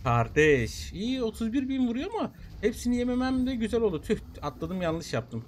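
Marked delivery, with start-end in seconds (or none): none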